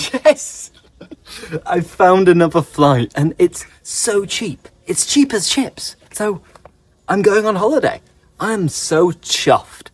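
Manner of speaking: high-pitched, thrilled good news; acted delight. Teacher acting out finding another cheap flight, chuffed and excited. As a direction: burst out delighted, pitch well up. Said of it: "so happy".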